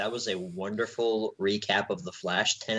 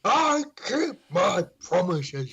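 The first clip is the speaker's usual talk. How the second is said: slower, higher, hammed-up villain vow; bad villain impression; 6 semitones higher, much slower than usual. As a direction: stretch out the hammy villain threat, slurring it. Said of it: "All right, that wasn't very good".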